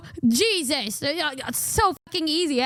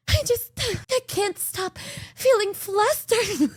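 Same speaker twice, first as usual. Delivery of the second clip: slow, higher mock of a flustered friend; 7 semitones higher, slower than usual. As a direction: drag it out like she's too shy to finish. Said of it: "she's just".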